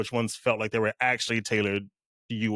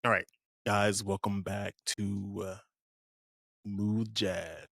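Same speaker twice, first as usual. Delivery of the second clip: smooth jazz radio host; 2 semitones lower, 6 dB quieter than usual. Shown as a demonstration. Playing jazz host back from commercial, smooth hushed DJ voice. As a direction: welcome listeners back softly and unhurriedly. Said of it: "if you were on a jazz radio right now".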